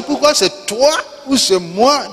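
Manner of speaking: ordinary delivery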